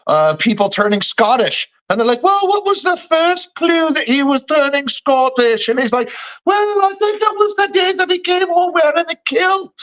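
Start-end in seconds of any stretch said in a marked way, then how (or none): 2.2-5.7 high, slow sketch-character voice; Monty Python. Retold or imitated. Retelling the sketch about people turning Scottish, high comic voice. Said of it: "Monty Python sketch of". 6.4-9.7 very high comic falsetto; shrieky sketch-character impression; Monty Python. Answering the interviewer in the Scottish sketch, absurd high voice. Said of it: "Monty Python sketch of".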